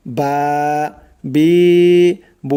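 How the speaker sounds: বা, বি, বু each vowel stretched one alif long; long madd vowels on বা বি বু; drawn-out vowels. Shown as a demonstration. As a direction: stretch বা বি বু slowly. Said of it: "এক আলিফ করে টেনে পড়তে হবে".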